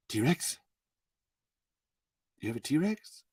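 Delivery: hushed, completely serious questioning; Sam Neill. Acted. Stunned visitor asking the park host, soft and serious. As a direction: drop it soft, dead serious and disbelieving. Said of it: "I have to do Sam Neill".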